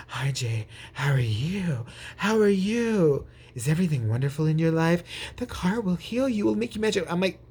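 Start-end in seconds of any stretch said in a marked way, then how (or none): 0.1-1.9 mock-cheery, fake welcome; smiley mannequin greeter. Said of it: "they were just too smiley and mannequin-y". 2.2-7.1 smiley mannequin greeter. Retold or imitated. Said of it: "they were just too smiley and mannequin-y".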